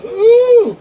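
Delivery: high, loud, stretched "Ooh"; a sudden squeal at the spectacle; surprised ooh. Retold or imitated. Watching the dancer on his friend, a loud high ooh.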